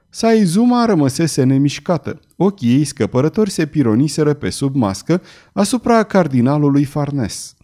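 usual speaking voice

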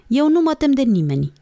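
put-on high-pitched childlike defiance; defiant boast. A narrator's reading. Girl defiantly denies fear to brother. (sfidare, pe ton înalt)

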